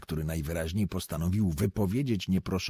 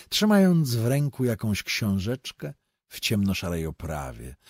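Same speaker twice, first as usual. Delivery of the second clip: plain reading voice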